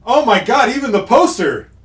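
loud, high-pitched amused disbelief; real excitement, voice shooting up; excited outburst. Real, not acted. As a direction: exclaim it, thrilled and incredulous. Gleeful reaction to film poster.

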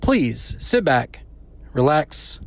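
ordinary delivery

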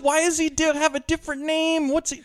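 mock confused viewer asking about the plot; confused girlfriend. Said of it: "Molly is like".

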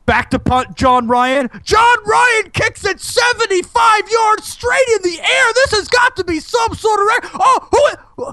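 very high shouted play-by-play; sports announcer. Pretend. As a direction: scream the call like a stadium announcer. Mock sports play-by-play call.

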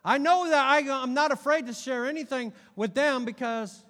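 loud, high-pitched impassioned preaching; animated. Real, not acted. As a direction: raise the voice in animated conviction. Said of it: "sorry for getting so animated about this".

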